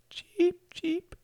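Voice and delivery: tiny, very high bird cheep; whispered falsetto. A narrator's reading. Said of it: "she whispered in a high falsetto".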